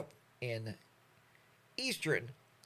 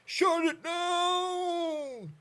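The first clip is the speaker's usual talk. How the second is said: much higher, very slow stretched call; drawn-out proclamation; 5 dB louder, much slower than usual. Pretend. Mimicking the world shutting down, high and very drawn out. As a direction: hold it out like a dramatic announcement.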